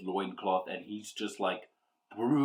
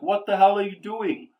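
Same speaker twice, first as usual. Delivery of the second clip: much higher, loud shout; alarmed yell; 11 semitones higher, 10 dB louder than usual. Acted. Sir Percy wakes and yells at Esmeralda, alarmed shout. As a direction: yell in shock at your ally. Knight wakes, sees demon untied.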